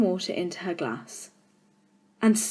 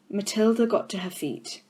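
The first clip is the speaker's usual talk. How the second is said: usual speaking voice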